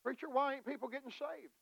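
fast, high, fiery exhortation; preaching at full force. Acted. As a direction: rouse the church hard and quick.

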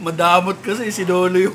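loud laughing tease. Real, not acted. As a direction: laugh it out loudly. Laughing teasing about noodles.

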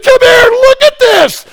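very high, loud call to come look; excited shout. Retold or imitated. Father calling his sons from the tent: excited shout. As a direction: yell for everyone to get out and see. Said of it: "I started to scream".

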